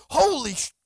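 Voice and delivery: high, loud blurt cut off short; amazed outburst. Retold or imitated. Healed man blurts out in church, amazed. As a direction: imitate a stunned outburst, cutting off the swear.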